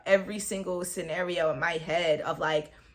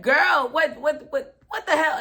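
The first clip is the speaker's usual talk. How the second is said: put-on higher, louder girl what the hell; stunned outburst, pitched up; shocked; 5 semitones higher, 5 dB louder than usual. Hearing the STD news, shocked outburst.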